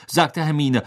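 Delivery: plain reading voice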